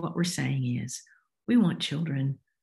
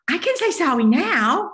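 put-on high, loud, hurried kid voice; little boy; 10 semitones higher, 5 dB louder than usual. Grandson in the car begging not to go home. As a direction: blurt it like a panicked little boy. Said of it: "he said".

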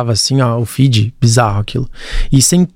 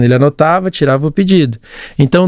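ordinary delivery

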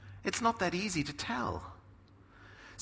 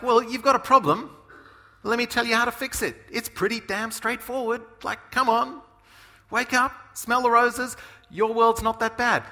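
higher, louder, no-nonsense lecturing; brisk fixer; 7 semitones higher, 7 dB louder than usual. Retold or imitated. Fixer lecturing a hurting friend, brisk and impatient. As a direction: brush off the problem and tell them to fix it. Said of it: "we're fixers".

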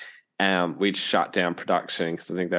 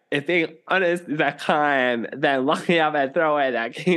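higher, tongue-out imitation with an Aussie accent; Lauren impression; 6 semitones higher than usual. Attempting Lauren's tagline for co-host, botched Aussie-sounding impression. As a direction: say it with the mouth never closing, Aussie-tinged accent. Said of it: "if Lauren was Aussie".

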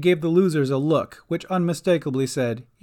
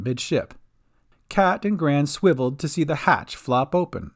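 ordinary delivery, nothing marked

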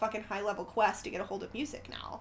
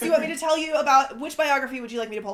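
loud, mock-eager show-off; mock know-it-all comeback; 5 semitones higher, 12 dB louder than usual. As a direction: fire back at a quizzer, starting high and loud. Mock comeback to bar quizzers.

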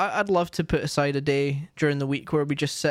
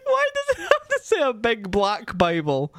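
incredulous; 8 semitones higher, 4 dB louder than usual. Real, not acted. Incredulous at the text.

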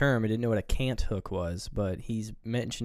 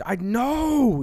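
very high, loud, drawn-out wail; imitated scream; 13 semitones higher, 8 dB louder than usual. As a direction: scream it out, mimicking a character breaking down. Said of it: "He f-, screams "No" really loud".

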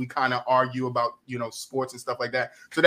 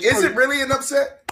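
high, louder disbelieving question; skeptical challenge; 11 semitones higher, 6 dB louder than usual. Real, not acted. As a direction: challenge whether the win was really an upset. Disputes the upset call.

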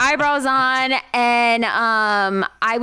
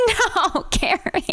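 mock crying; 9 semitones higher, much slower than usual. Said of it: "I just started crying and I'm like".

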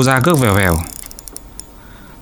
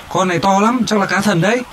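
high, excited call from afar; 10 semitones higher, 3 dB quieter than usual. A narrator's reading. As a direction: shout it from across the river. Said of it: "nói vọng lại".

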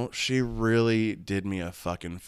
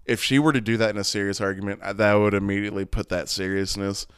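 ordinary talk

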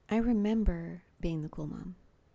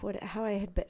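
ordinary talk, nothing marked

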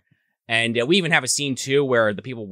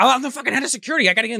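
frantic security chief; 11 semitones higher, much faster than usual. Retold or imitated. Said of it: "He's like".

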